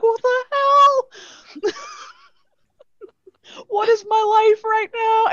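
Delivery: very high, loud, incredulous squeal; laughing disbelief. Retold or imitated. Hotel clerk reacting to caller's shade, high laughing disbelief. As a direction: exclaim it helplessly through laughter.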